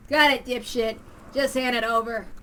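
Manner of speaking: loud, contemptuous order; rude demand. Acted. Thug demanding the money envelopes, loud and contemptuous. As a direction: shove the words at them.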